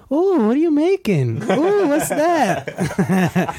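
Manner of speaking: high excited woman voice. Retold or imitated. Guy imitating a girlfriend peeking at his cooking, high mock coo. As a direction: playfully imitate a nosy girlfriend, high and drawn out. Voicing a woman eyeing food.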